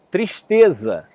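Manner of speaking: grito forte de tristeza. Acted. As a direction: grite a palavra para a floresta. (menino grita para a floresta, com voz forte)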